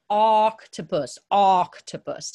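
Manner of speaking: "octopus" with a loud, long opening "o" as ah; slow "octopus", leaning on the first vowel; emphasized first sound. Shown as a demonstration. Teacher helping students hear the O, stretched first sound.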